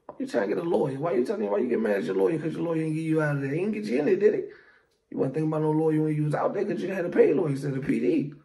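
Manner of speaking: high, loud exasperated rant; heated scolding. Pretend. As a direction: lay into him with loud, rising disbelief. Mock scolding of jailed gangster.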